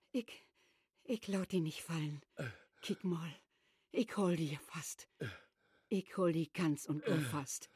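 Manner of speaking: soft, low, soothing; tender reassurance. Acted. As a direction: hold him close and calm him. Mother soothes husband tenderly.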